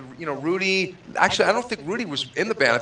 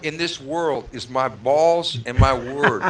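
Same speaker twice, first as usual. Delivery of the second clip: slow, weighty tough-guy movie impression; Al Pacino; slower than usual. Quoting a movie tough guy to friends, slow impression. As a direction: draw it out like a screen gangster's vow. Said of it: "you can quote Al Pacino".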